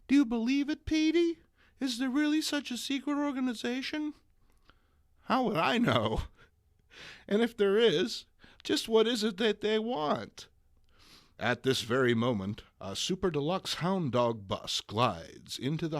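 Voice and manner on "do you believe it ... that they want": put-on much higher, louder girlish voice; excited young woman. MJ questioning Peter about the group, high excited girl voice. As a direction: pester him in a squeaky high voice.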